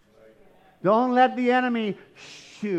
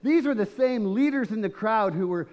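ordinary delivery

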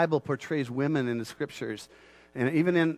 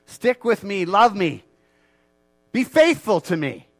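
loud, high, fervent preaching; triumphant loud praise of Jesus as king; preaching at full force; 4 semitones higher, 7 dB louder than usual. Acted. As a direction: lift the congregation up, full voice.

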